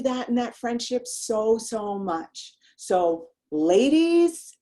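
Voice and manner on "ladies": higher, louder call to the audience; rallying call to the ladies. Real, not acted. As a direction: call out to get their attention. Calling out to audience.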